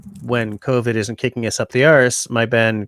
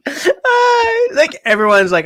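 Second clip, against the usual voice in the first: high, laughing through the words; 7 dB louder, slower than usual. Real, not acted. S0 laughing at the accent attempt. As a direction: crack up while talking. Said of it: "Oh my gosh".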